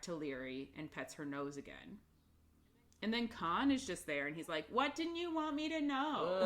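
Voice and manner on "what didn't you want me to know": high, imitated confrontation; mock demanding. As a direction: retell a pointed question, pitched way up. Khan confronts in retold scene.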